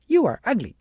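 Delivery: high, loud parroted taunt; mocking echo. A narrator's reading. The child mirrors Agnes's insult, high and loud. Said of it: "And the little girl said".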